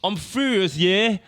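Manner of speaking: high, loud British rock frontman; hammed-up indignant singer with a British accent. Host copying a colleague's endless impression, loud mock-furious British singer. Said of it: "a [name] impression yesterday".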